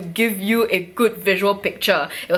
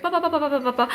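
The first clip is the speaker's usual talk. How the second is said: quick run of pa-pa-pa, pitched up; rapid footstep noises; 6 semitones higher, much faster than usual. Retold or imitated. Woman retelling her rush downstairs, rapid imitated footsteps. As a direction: imitate feet racing down steps. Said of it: "I was very, very fast".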